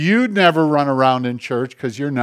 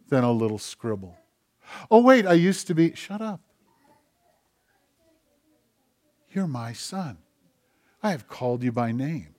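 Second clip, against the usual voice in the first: soft voice of God; 6 dB quieter than usual. Retold or imitated. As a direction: speak softly like God to a child. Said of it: "God whispers to you and said".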